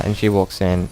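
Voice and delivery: ordinary talk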